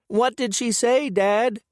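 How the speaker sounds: put-on high, loud kid calling down stairs; child bellowing. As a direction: yell it up high like an eager boy. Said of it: "Ollie bellows down the stairs".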